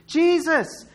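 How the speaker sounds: high repeated call, easing off each time; high-pitched mock holler; crying out over and over. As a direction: keep calling doggedly for attention. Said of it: "He just carries on".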